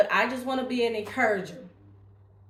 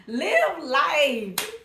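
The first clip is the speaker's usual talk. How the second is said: laughing; 4 semitones higher, 5 dB louder than usual. Real, not acted. Laughs while urging.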